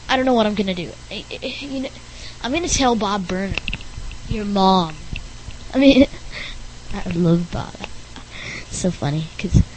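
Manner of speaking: ordinary talk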